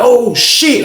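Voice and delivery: high, loud surprised yelp; shocked exclamation. Retold or imitated. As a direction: react like you can't believe he's back. Inmates react to Unc's return.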